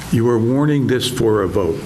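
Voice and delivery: ordinary talk, nothing marked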